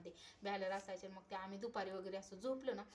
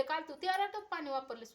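retold scolding; 7 semitones higher, 6 dB louder than usual. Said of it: "मला ओरडले की".

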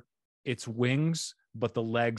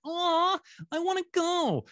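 squeaky, much higher whine of a disappointed fan; whiny mock fan; 3 dB louder than usual. A listener stuck far from Phoenix, mock whining. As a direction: whimper it like a let-down listener who can't go.

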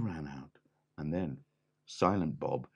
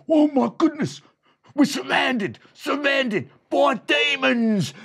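terrified shout; 10 dB louder than usual. A narrator's reading. Bandit to his gang, screaming in high, loud terror. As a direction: shriek that you're surrounded. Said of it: "Scarface Bob said".

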